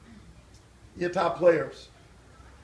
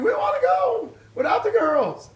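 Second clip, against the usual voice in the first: put-on high, slow childish shout; kids screaming; 12 semitones higher than usual. Kids begging mother from the car, shouting high. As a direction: yell it like a begging kid. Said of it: "we were screaming".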